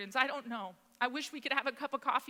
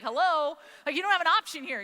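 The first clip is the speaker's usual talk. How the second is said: loud, higher mock disbelief; pointed retold "hello?"; incredulous; 4 semitones higher, 6 dB louder than usual. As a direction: imitate an exasperated husband stating the obvious. Retold husband's incredulous reply.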